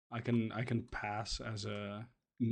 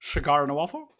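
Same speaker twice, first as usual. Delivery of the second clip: put-on loud, higher comic Dutch accent; caricatured Dutch accent, pitched up; Goldmember; 4 semitones higher, 8 dB louder, faster than usual. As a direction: offer it as a silly Dutch villain. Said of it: "gold member Dutch accents".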